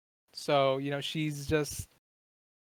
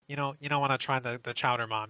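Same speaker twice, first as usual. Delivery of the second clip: a slightly more nasal take on Joe's voice; 2 semitones lower than usual. Shown as a demonstration. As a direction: squeeze the words a bit through the nose, like Joe. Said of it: "a little bit more nasally".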